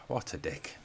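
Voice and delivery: fed-up fan muttering at a blunder; exasperated mutter. Real, not acted. Sighs after player gives foul.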